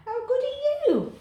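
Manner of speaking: high-pitched cat praise. Real, not acted.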